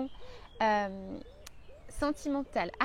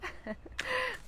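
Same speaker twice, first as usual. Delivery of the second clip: high soft laugh; 11 semitones higher, 5 dB quieter than usual. Real, not acted. Laughing after her joke.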